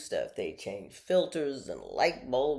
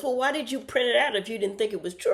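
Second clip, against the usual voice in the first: high, quick, louder question; mock challenging son; 10 semitones higher, 6 dB louder, faster than usual. Son questioning why mom printed it, high and challenging. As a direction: rush out a pointed challenge as the son.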